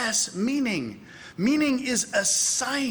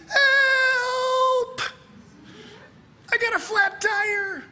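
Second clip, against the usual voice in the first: very high, drawn-out comic wail; mock cry for help; 4 dB louder, much slower than usual. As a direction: call out in hammed-up distress, slow and piercingly high. Acts out an SOS distress call.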